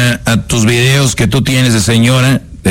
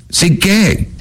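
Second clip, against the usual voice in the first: put-on pushy girlfriend; 8 semitones higher than usual. Girlfriend voice pushes.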